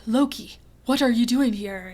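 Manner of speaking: lightly voiced Thor with a Scottish lilt; accidentally Scottish. Fan voicing Thor finding Loki on the couch, unintentionally Scottish. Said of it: "that sounded really Scottish".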